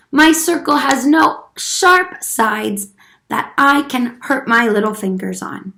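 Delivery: ordinary delivery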